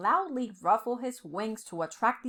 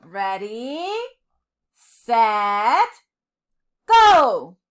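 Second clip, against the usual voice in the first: starting call; 12 dB louder, much slower than usual. A narrator's reading. King starting the race, building to a loud shout.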